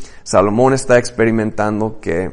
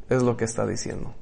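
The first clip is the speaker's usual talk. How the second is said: quiet matter-of-fact; 3 semitones lower, 10 dB quieter, faster than usual. Acted. Preacher answers flatly, quick and soft.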